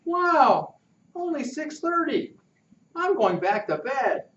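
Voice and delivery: put-on high whiny boy voice; a lightly voiced kid refusing to get up; Pudge, grumbling. Sleepy Pudge complaining.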